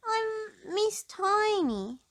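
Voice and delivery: high, slow, halting squeak; frightened little mouse voice; shy tiny mouse. A narrator's reading. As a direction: stammer out her name, scared.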